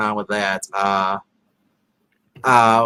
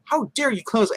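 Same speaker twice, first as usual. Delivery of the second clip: mock outrage; 10 semitones higher, 3 dB quieter than usual. Quoting the blinds standoff.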